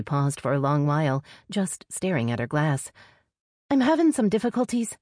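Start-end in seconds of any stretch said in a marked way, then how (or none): 3.7-5.0 high-pitched, sudden female confession; blurted out. A narrator's reading. Sister finally admitting trouble to her brother, blurted high. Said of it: "she finally blurted".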